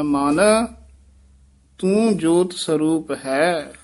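ਮਨ set apart by a small break before ਤੂੰ; pause after ਮਨ. Shown as a demonstration. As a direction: hold a short pause after ਮਨ, then say ਤੂੰ ਜੋਤ ਸਰੂਪ ਹੈ. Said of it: "ਮਨ ਪਦ ਤੇ ਯਮਕ ਲਾ ਦੇਣੀ ਹੈ".

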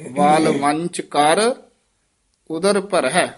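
slow ਵਲਵੰਚ ਕਰ, then a clear pause before ਉਦਰ ਭਰਹਿ; teacher's slow model of ਵਲਵੰਚ ਕਰ; careful recitation with pause. Shown as a demonstration. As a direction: break after ਕਰ like a reading teacher. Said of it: "ਇਹ ਵਲਵੰਚ ਵੱਖਰਾ ਪਾਠ ਹੈ".

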